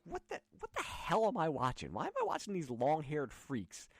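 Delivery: a confused parent staring at the screen; baffled 70s parent. Retold or imitated. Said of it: "are you like".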